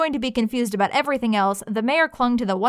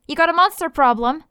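brash question; 6 semitones higher, 6 dB louder than usual. Acted. Hunter asks mayor loudly.

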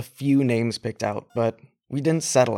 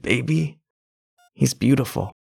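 usual speaking voice

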